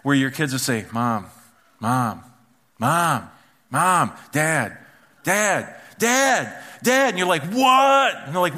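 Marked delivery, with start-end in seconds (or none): 0.9-7.1 put-on kid calling parents. Kids calling a parent who isn't listening, insistent escalating calls. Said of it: "your kids are calling your name". 7.4-8.1 high, loud snapped "what"; exasperated yell. Acted. Parent finally answering the calling kids, exasperated yell. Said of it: "Why are you yelling at me?".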